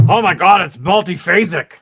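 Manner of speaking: Star Trek crew member. Retold or imitated. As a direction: gasp it out like a Star Trek officer. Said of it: "it's like they're looking at something".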